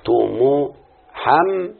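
تو مو حم split into slow separate beats; syllable by syllable. Shown as a demonstration. Teacher to pupils breaking the word apart, very slow syllables. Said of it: "لازم الكلمة كلها تبقى".

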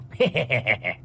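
drawn-out cruel chuckle; villain's gloating laugh; menacing snicker. A narrator's reading. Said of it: "còn cười gằn lên mà nói".

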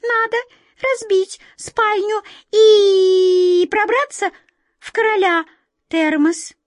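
high, loud piping with a long-held squeal; tiny mice piping one after another; squeaking mice. A narrator's reading. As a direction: squeak each word out in turn. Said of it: "торопливо пропищали".

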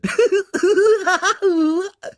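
very high, crazed hyena cackle; long, manic high-pitched giggle. A narrator's reading. Hyena Ed answers by giggling madly. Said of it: "Ed kicherte".

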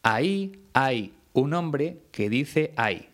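slow, carefully marked classroom example; slow teacher demo sentence; slow clear example. Shown as a demonstration. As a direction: lay out each word of the sentence slowly and separately. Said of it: "un ejemplo muy típico para explicar".

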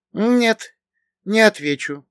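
put-on firm refusal in a high girlish voice; confident girl. A narrator's reading. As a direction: refuse with assurance, pitched well up. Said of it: "вполне уверенно произнесла Ветлина". (уверенный высокий девичий голос)

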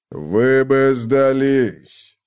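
slow, far, rumbling giant; rumbling mock concern. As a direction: rumble it with fake care. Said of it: "сложной заботой пророкотала". (рокочущий голос с заботой)

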